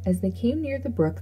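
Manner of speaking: ordinary delivery, nothing marked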